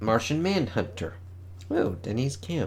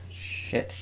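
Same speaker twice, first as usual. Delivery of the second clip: whispered; 8 dB quieter than usual. Real, not acted. Muttered reaction to cover.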